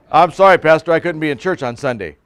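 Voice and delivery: mock excuse. Mocking a congregant's excuse.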